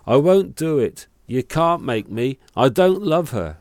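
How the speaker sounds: loud, high screaming protest; shouting. Acted. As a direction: yell it from inside the sack. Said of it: "Then he began to shout".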